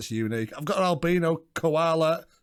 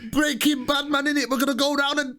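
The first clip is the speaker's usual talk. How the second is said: high mock patois voice; playful put-on patois, pitched up; Bad Man patois accent; 9 semitones higher than usual. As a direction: keep up the hammed-up patois accent. Carries on the Bad Man parody.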